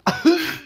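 high, loud "ahoo" like a dying sneeze; imitated dying sneeze. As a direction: mimic someone dying of the flu. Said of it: "like someone dying of the fucking flu".

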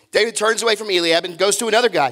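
ordinary talk

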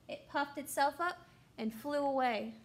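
plain reading voice

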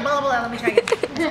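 ordinary talk, nothing marked

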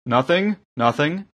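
quick high, rising denials; excusing himself. A narrator's reading. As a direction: brush it off apologetically. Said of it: "Kazinda excused himself".

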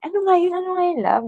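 high, pleading voice trying to remember; straining to recall. Retold or imitated. Partner asking love about the song, high and pleading.

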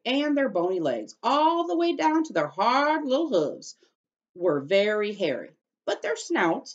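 put-on lively read-aloud to children; storybook reading. A narrator's reading. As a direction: read it like story time for kids.

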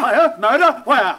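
startled. Acted. Jack startled by the servant's cries of murder, surprised. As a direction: jump at the news.

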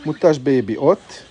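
ordinary talk, nothing marked

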